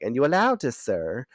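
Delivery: Kendra. Retold or imitated. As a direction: put on a mock Irish-Caribbean accent. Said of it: "I sound like an Irish person".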